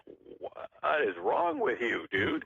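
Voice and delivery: neighbors mocking. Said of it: "they're like".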